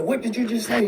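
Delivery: high-pitched, confrontational question; mock aggressive. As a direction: imitate a son challenging his mother. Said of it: "He got real aggressive".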